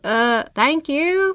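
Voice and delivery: slow, gushing thanks. Acted. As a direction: gush with gratitude. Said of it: "he gushed".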